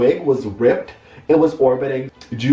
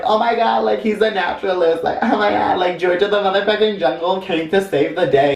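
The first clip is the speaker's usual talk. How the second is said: gushing women; 6 semitones higher than usual. Retold or imitated. Women gushing over the man.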